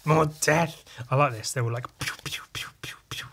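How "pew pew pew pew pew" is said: whispered pew pew; soft whispered shooting noises; pew sounds. Pretend. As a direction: breathe out tiny shots. Voicing laser shots.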